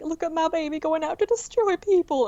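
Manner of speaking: mock-proud crowing over a child; proud parent. Acting proud parent in Mulan bit.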